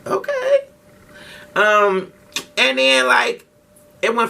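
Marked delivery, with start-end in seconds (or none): 0.0-0.7 much higher, near-whispered clueless wonder; mock naive excitement. Telling Leah he thinks a celebrity is coming, airy and high. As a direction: play it dumb and curious. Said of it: "I'm chilling with Leah like".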